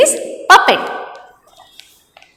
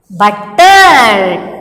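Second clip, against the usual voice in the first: butter louder, stretched out slowly; stress on butter; 3 semitones lower, 4 dB louder than usual. Shown as a demonstration. Teacher shows children the double-letter word, stressed butter. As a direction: draw out "butter" with a forceful second syllable. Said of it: "we are stressing the word here".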